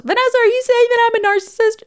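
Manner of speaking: high, quick offended voice; imagined listener. Pretend. Imagined listener asks the host, high and offended.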